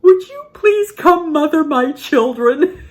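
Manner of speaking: high, loud, slow plea of an amazed shopper; impressed stranger. Retold or imitated. Shopper next to them begging grandma, loud and high. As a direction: beg like a worn-out mother. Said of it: "the lady in the cart right next to us said".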